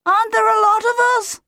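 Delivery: very high, piercing yell. A narrator's reading. Otto to Claudius at the huddle, high yelling. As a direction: yell it upward in a tiny chick's voice. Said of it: "Otto yelled up to Claudius".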